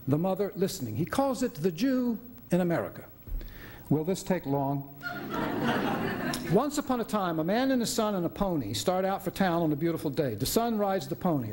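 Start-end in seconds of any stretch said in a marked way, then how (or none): 4.9-6.5 short breathy laugh. Acted. Doctor laughs at long story.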